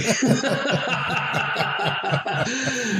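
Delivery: a long, high, real laugh at the memory. Real, not acted. Laughs at his own story.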